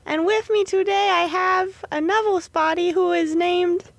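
put-on a breezy British host intro accent. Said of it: "stop talking in a British accent".

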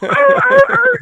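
string of very high-pitched yelps; imitated dog yelps. Hunter mimicking dog fleeing with pheasant, imitated yelps. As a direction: mimic a running dog getting zapped.

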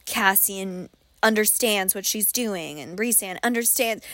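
ordinary talk

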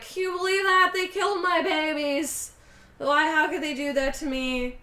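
high, loud, hammed-up lament; mock sad wail. Chuck complains to strangers at bar, mock wailing. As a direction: wail it like a self-pitying barfly. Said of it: "now he's sad again".

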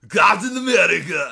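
loud, high, hammed-up German accent; bad German accent. Second try at the film's buyer, bad German accent. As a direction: overdo a badly faked German accent. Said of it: "how to do a German accent".

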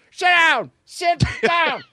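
high shouted ferry-skipper voice, laughing; George Lucas impression. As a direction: bark orders at passengers in a high voice. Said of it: "I can't do a good George Lucas".

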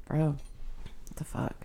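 slow whispered disbelief; quiet stunned aside. Real, not acted. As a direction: mutter it under the breath. Sudden aside.

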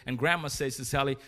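usual speaking voice

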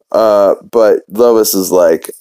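normal talking voice

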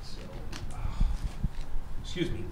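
talking with a runny nose; sniffly from the cold. Real, not acted. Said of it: "My nose is running".

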